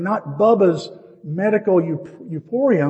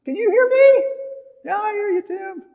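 very high, squeaky distant exchange; mock far-off voices; 4 dB louder than usual. Doctor and drifting patient calling to each other, very high mock-distant voices. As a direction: pitch it way up, as if heard through anesthetic. Acts out hearing warped by anaesthesia.

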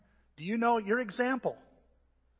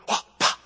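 punchy imitated martial arts noises; explosive, voiceless mock strike sounds; 6 dB louder than usual.

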